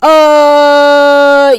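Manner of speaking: high, loud stunned exclamation; loud shocked uh. Real, not acted. Vlogger reacting to the fight, loud shocked uh. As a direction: blurt it out in shock.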